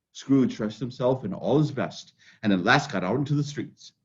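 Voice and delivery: plain reading voice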